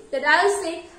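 usual speaking voice

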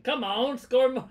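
loud, high football hooligan yell. Acted. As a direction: shout it like a rowdy British football fan. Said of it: "you do the football hooligan".

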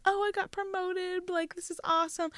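high, gushing put-on voice, a bit soft; mock gushing friend. Mimicking a friend bragging about her promotion, high and gushing. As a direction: play the bubbly friend showing off her news.

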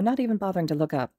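plain reading voice

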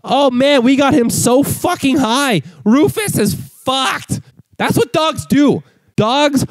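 high, giggly stoner delight; mock stoner glee, slowing at the end; stoned dog owner. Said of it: "You go".

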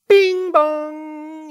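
imitated chime. Imitating flight attendant chime.

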